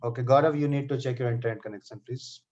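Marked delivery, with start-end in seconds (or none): none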